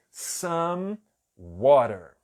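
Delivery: "Some water" stretched very slowly; slow English demo. Shown as a demonstration. Teacher drawing out "some water" very slowly for learners. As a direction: drag out "Some water" so each sound is heard. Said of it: "O último som que tem aqui é o som de M".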